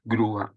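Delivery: Grúa, split gru-a, a clear stress on the u; said as a model word. Shown as a demonstration. Teacher models the word Grúa for the class, stressing the u. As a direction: give Grúa slowly, gru-a with a strong u. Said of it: "por ejemplo, la palabra grúa".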